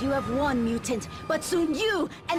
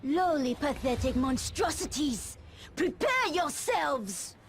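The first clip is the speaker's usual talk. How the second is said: menacing challenge; 11 semitones higher, much slower than usual. Acted. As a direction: call out contemptuously, stretching the final words. Villain challenges the heroes to fight.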